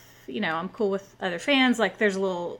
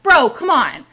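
loud, higher-pitched retold complaint; exasperated protest; 6 semitones higher, 8 dB louder, slower than usual. Woman retelling her plea to the guy, exasperated. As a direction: protest loudly, fed up.

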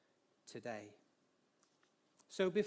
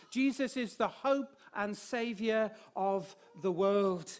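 louder, higher ringing proclamation; preaching at full force; 3 semitones higher, 5 dB louder than usual. Acted. Preacher declaring the finished work of the cross, full-force preaching. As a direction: proclaim it to the rafters.

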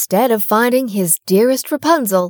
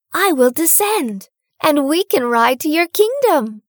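put-on higher young girl's voice, earnest; Rapunzel voice; 7 semitones higher than usual. A narrator's reading. Rapunzel telling the prince her plan, earnest girl voice. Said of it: "told the king's son".

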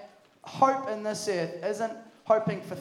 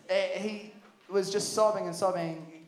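ordinary talk

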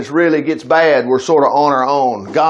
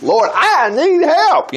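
high, drawn-out cry for help; acted pleading, high and slow; mock plea; 10 semitones higher, much slower than usual. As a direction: stretch it out like an exasperated plea. Acted plea for own problems.

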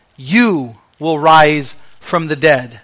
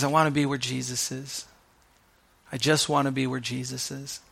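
much softer, yearning inner voice; soft longing; 4 semitones lower, 13 dB quieter than usual. Retold or imitated. Mary Magdalene longing to be with Jesus, hushed. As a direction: say it like a quiet heartfelt wish.

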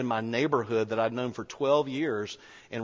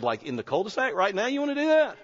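astonished; 8 semitones higher than usual. Retold or imitated. Amazed neighbour wants to pray now.

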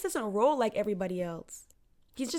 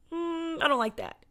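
doubtful; 8 semitones higher than usual. Retold or imitated. Voicing doubters of Jesus.

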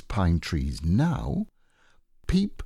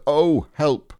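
Pooh calling for help; 6 semitones higher, 6 dB louder than usual. Acted.

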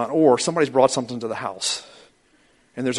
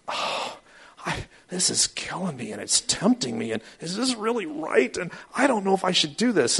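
mock anxious fretting; 8 semitones higher than usual. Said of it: "the person who's going".

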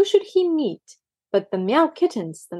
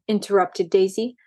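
usual speaking voice

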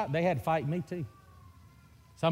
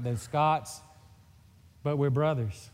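usual speaking voice

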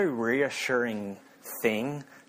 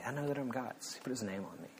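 much softer, almost to oneself; quiet self-reassurance; 13 dB quieter than usual. Acted. Believer reminding themselves whose they are, quiet self-reassurance. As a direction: drop the volume right down for a private assurance.

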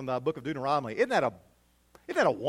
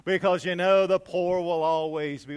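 resigned tone; 3 semitones higher, 10 dB louder than usual. Retold or imitated. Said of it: "in this tone of resignation".

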